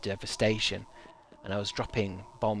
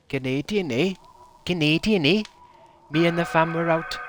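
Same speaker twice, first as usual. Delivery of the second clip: badly faked Canadian accent, getting louder; 6 semitones higher, 5 dB louder than usual. Shown as a demonstration. Streamer trying out Canadian for chat, bad Canadian accent. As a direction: fumble your way into a Canadian accent. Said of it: "I can't do Canadian accent".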